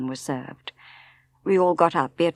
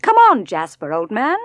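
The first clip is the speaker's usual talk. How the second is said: calling the dog; 3 semitones higher, 9 dB louder than usual. Acted.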